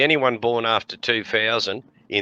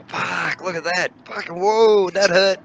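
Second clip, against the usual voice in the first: high, excited cheering; excited fight watching; 8 semitones higher, slower than usual. Retold or imitated. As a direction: whoop at the brawl below.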